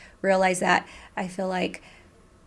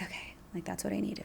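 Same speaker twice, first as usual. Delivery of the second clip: whispered relief; 13 dB quieter than usual. Retold or imitated. Whispered relief after crying in car.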